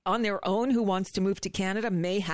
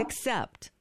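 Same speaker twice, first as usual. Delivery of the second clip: usual speaking voice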